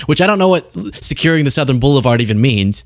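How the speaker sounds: ordinary delivery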